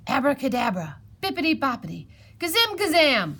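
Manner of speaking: ordinary talk, nothing marked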